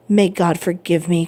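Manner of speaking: plain reading voice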